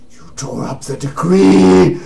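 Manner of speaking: loud, high, drawn-out mock wail; outraged paladin. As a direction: cry out in hammed-up dismay. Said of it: "He looks at you".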